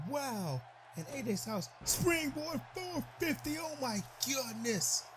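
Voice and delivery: high, thrilled play-by-play shout of amazement; excited call. Real, not acted. Commentator thrilled by a springboard four fifty. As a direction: sell the big move with rising excitement.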